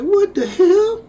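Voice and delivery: high, stretched outraged surprise; stunned what the hell. Retold or imitated. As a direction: recoil in shock. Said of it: "You're like".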